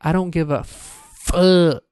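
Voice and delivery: "fuck" hit hard, the whole line slowed right down; capitalized F. Shown as a demonstration. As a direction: land on "fuck" like it's in capitals. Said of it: "the F would totally be capitalized".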